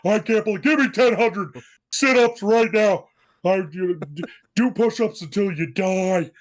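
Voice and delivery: loud, forceful military instructor impression; imitation drill sergeant dressing down a recruit. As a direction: bellow orders like a basic-training sergeant. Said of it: "he talked like this".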